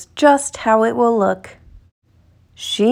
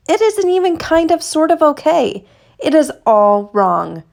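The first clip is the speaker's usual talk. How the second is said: dismayed; 6 semitones higher, 3 dB louder than usual. A narrator's reading. Book's letdown moment read aloud.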